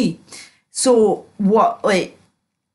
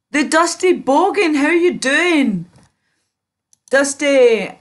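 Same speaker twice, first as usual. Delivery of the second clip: higher and louder, a drawn-out hello; excited greeting; 6 semitones higher, 4 dB louder than usual. Real, not acted. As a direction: call out to a friend who just dropped in. Loudly greets a viewer.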